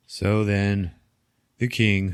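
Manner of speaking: ordinary delivery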